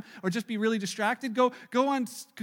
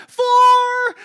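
high, loud golf warning cry of Four!; yelled Four; 14 semitones higher, 14 dB louder than usual. Retold or imitated. Golfer's wife yelling across the hole, loud Four!. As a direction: bellow Four! across the green. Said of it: "she yells".

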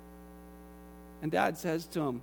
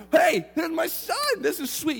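light up at the sight of him; overjoyed dad spotting his son; delighted father; 10 semitones higher, 5 dB louder than usual. Acted. Father spots returning son.

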